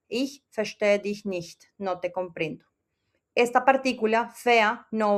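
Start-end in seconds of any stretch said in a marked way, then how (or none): 0.1-1.6 slow German example. Shown as a demonstration. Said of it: "que es comprender".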